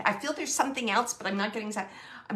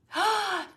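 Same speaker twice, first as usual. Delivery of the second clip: sharp gasp; 10 semitones higher, 4 dB louder than usual. Real, not acted. Medium receives a sudden message.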